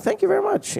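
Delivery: high squeaky thank-you; mock proud reply. Answering the director's praise, a high squeaky mock-proud thanks. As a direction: play it as a comically chuffed, squeaky reply. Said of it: "I'm like".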